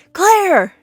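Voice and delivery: high, loud shout of a name; imitated husband hollering through the house; calling out. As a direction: yell for a wife who didn't answer. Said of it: "Claire doesn't respond. So then he says".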